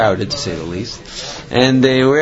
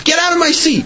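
imitated outburst in a much higher voice; retold angry yell. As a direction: act out an irate passenger yelling. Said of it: "he starts yelling at her".

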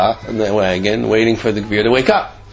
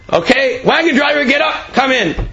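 calling out; 12 semitones higher than usual. Retold or imitated. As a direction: summon him from the doorway. Rabbi calling into waiting room.

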